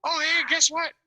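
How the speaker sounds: imitated yelling. Teller as woman inviting boater for soup, high mock yell. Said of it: "She's yelling at him".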